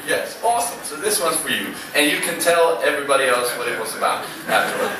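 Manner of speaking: ordinary talk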